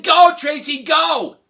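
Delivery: loud high shouting cheer for a runner. Retold or imitated. Crowd in the bleachers urging Tracy to run, shouting. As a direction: yell it from the bleachers.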